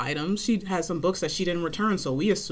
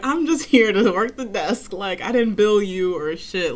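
louder, slow, exasperated; exasperated retort; 4 semitones higher, 7 dB louder, slower than usual. Retold or imitated. Clerk telling patron she only works the desk, slow and exasperated. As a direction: spell it out slowly, fed up.

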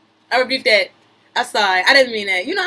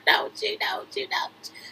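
fading into a whisper; tease, trailing off to a whisper; teasing whisper; 6 semitones higher, 9 dB quieter than usual. Acted. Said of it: "You want me to stop?".

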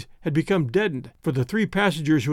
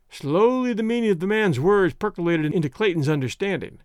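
plain reading voice

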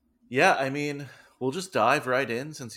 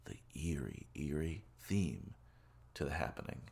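spooky hush; 4 semitones lower, 8 dB quieter than usual. Pretend. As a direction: creep the words out like a ghost story. Spooky intro of a theme.

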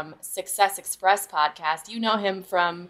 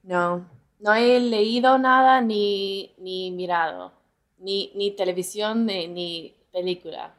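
put-on Spanish accent. Telling her co-host she hasn't practiced, in a Spanish accent. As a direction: talk like a native Spanish speaker, easygoing. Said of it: "It's uncanny how you sound Spanish".

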